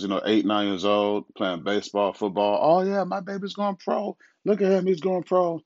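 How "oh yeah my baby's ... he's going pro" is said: high, doting mock parent; mock bragging parent. Father mocking parents of eight-year-olds, high gushing voice. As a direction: gush like a parent sure the kid goes pro.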